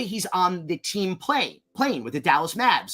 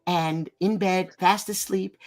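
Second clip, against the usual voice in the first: soft, slow, hushed storyteller; 2 semitones higher, 3 dB quieter, slower than usual. Retold or imitated. Star tells filmmakers of her night, softly and slowly. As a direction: lightly imitate a star slowly setting a dramatic scene. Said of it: "She goes".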